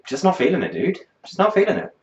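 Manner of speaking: high, weary dismissal; deflated, done with it; exasperated. Real, not acted. Dismissing the song.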